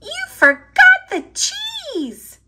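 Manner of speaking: high, loud whiny cry of dismay; a little mouse wailing over lost cheese; whiny complaint. Acted. Squeak to Pip, dismayed he forgot the cheese, in a high whiny wail.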